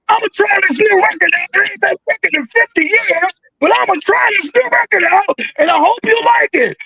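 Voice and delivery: high, mocking stage announcement; mocking a washed-up artist. Mocking artist trying new song.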